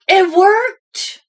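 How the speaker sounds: high, loud triumphant burst; triumphant exclaim. A narrator's reading. Storyteller announcing success, high and loud. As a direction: proclaim the win.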